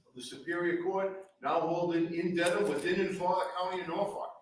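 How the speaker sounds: formal courtroom proclamation, ringing; grand rich voice. Acted. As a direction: call the court to order like a crier. Court officer opens the session.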